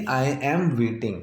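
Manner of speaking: very slow, each word laid out; slow teaching example; slow demo. Shown as a demonstration. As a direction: drag it out for the class. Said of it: "ये present continuous ही है".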